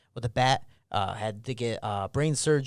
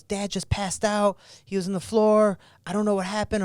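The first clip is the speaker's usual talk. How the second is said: put-on higher, strained retelling of a frightened kid; panicked brother; 8 semitones higher, 4 dB louder than usual. Brother on the phone reporting dad collapsed, imitated in panic.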